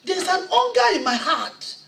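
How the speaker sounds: high, loud revival sermon delivery; full-force preaching. Acted. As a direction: preach your testimony with rising fire. Impassioned testimony, preaching hard.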